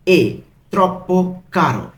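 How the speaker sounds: "এ ... troppo ... caro" with pauses; one word at a time on "এ troppo caro"; broken into pieces. Shown as a demonstration. Teacher translating too expensive for learners, word by word. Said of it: "আমি কিন্তু ভেঙে ভেঙে বলছি".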